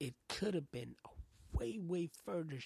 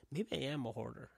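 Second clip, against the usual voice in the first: ordinary talk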